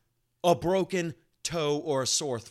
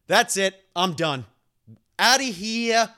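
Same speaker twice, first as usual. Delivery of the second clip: higher, much louder hammed-up storm-out; mock fed-up dad; 7 semitones higher, 10 dB louder than usual. Son mocking what his mother fears his dad will say, hammed-up angry exit. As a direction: play the husband slamming out the door. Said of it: "That he's gonna come home and, like".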